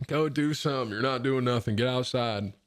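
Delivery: lightly voiced scolding dad; a hint of a put-on father's voice; imitated dad voice. Dad telling son to go outside, mock gruff nagging. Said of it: "making him talk like that".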